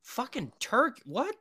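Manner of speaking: disbelieving, rising to a loud Turkish?; stunned, can't believe she learned Turkish; incredulous surprise. Real, not acted. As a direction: double take at the news.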